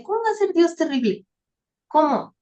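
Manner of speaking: high-pitched puzzled protest, falling at the end; retold incredulity. Believer puzzled by a hymn's words, incredulous. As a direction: question it as if it cannot be.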